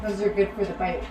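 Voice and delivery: chewing while talking; speaking around food; mouth full. Real, not acted. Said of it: "talk with your mouth full".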